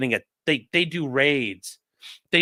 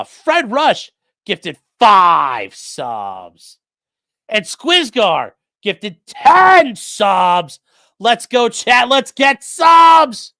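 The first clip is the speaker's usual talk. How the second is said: loud, slow, drawn-out hype; rally the chat into a frenzy, drawn out; hype shout; 6 semitones higher, 9 dB louder, much slower than usual. Real, not acted. Streamer hyping gifted subs.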